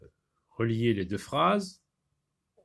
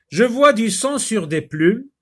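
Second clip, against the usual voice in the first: higher, louder expressive read; reading aloud; 11 semitones higher, 9 dB louder than usual. Shown as a demonstration. Teacher reading the example to the class, expressive.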